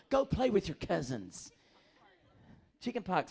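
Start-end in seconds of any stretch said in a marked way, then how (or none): 0.1-1.5 mock loud instruction. Mimicking mom sending kids.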